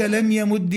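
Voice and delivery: "يمد" ending in "i", yamuddi; kasra ending. Shown as a demonstration. Teacher listing allowed forms for parsing, "يمد" with kasra. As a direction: say "يمد" with doubled d and short "i". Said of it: "والكسر أيضًا يجوز".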